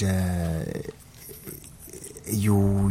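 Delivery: usual speaking voice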